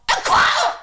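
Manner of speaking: sudden high panic, kwal stretched; alarmed shriek. Acted. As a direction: yelp een kwal! at a jellyfish. Spotting a jellyfish.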